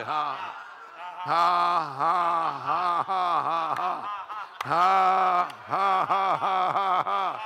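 slow, separate "ha" bursts; declamatory forced laughter; deliberate faith laugh. Shown as a demonstration. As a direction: laugh on purpose, one loud ha at a time. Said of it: "laugh for a few minutes".

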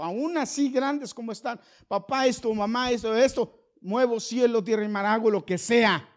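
high, loud pulpit cry; fiery preaching. Acted. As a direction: call it out to the whole congregation. Preaching at full force.

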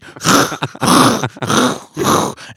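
high, unvoiced wheezing laugh; breathless laugh. Real, not acted. Laughing at his own fishtail, breathless wheezing laugh.

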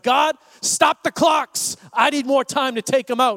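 high, loud battlefield plea; urgent shout. Acted. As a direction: call up to heaven, punching each word.

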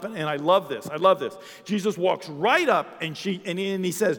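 loud, high-pitched sermon storytelling; animated preaching. Real, not acted. Preaching with rising intensity.

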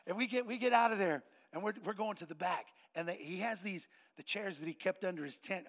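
ordinary delivery

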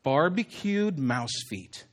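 slow, low, hushed unveiling; mock big reveal. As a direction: present a silly idea with slow gravity. Mock unveiling of a silly idea.

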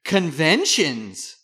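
loud, drawn-out mimicry; mocking stock excuse. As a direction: stretch the word out in mock officialdom.

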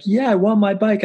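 high-pitched mimicked kid's whine; crying daughter. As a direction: imitate a tearful child insisting. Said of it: "started crying about it".

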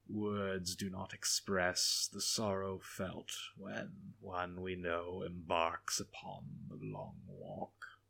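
slow, soft, sugary with hidden venom; sweet tones hiding venom; sweet but venomous. A narrator's reading. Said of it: "He spoke in sweet tones, but an undercurrent of venom".

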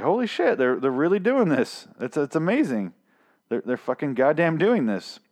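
high-pitched awestruck outburst; amazed reaction. Retold or imitated. Fan retelling his reaction to the bat reveal, amazed. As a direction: relive your disbelief, voice jumping up.

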